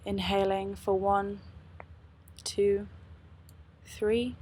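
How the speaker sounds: calm, unhurried meditation guide; guided meditation. Acted. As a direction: lead them gently into stillness.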